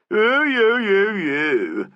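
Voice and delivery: high, loud jeering mimicry; mock him like a cheeky street kid; mocking street urchin echo. A narrator's reading. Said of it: "Saint echoed ... exasperated facetiousness".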